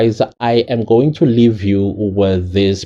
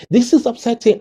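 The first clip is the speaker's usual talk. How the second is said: high, pained cry of upset; 13 semitones higher, faster than usual. Real, not acted. Vlogger venting to viewers, high and upset. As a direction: let the hurt break through. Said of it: "I am livid".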